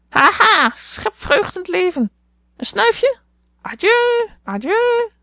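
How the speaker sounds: heel hoog, luid papegaaiengekrijs; schreeuwende papegaai. A narrator's reading. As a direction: schreeuw het als een opgewonden papegaai. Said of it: "schreeuwde Polly". (papegaai Polly schreeuwt heel hoog en luid)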